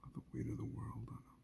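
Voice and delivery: soft whispered ending; hushed and heavy. Real, not acted. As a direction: let it fade to a whisper.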